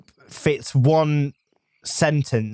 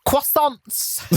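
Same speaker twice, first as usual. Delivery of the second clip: exaggerated British accent, stretched on "croissants"; 3 semitones lower than usual. French person showing off to friends, mock British accent. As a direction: put on British English, drawing out the last word. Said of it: "us saying it in a French accent".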